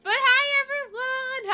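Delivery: high, loud bright hello to chat; excited greeting. Real, not acted. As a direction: greet the whole chat with a burst of energy.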